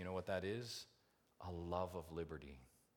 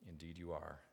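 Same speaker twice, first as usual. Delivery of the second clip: ordinary delivery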